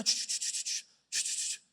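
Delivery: chasquidos susurrados ch ch ch; ruido de borracho. Retold or imitated. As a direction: imita el siseo de un borracho tirado en el piso. Imita al borracho en el piso. (siseo de borracho en el piso)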